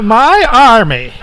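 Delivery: high, drawn-out character voice; indignant knight figure. Acted. Game master repeats the knight's outburst, high and mock-indignant. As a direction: push the pitch up in mock outrage. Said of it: "this guy speaks out".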